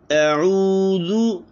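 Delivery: slow chanted अऊज़ु with rounded lips; recitation demo. Shown as a demonstration. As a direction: draw out अऊज़ु like Quran recitation. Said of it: "ये है इसके पढ़ने का तरीक़ाकार".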